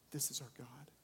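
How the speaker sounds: whispered repeat of the line, breath only. Acted. Preacher ending on God's mercy: whispered repeat. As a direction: let it fall to a whisper.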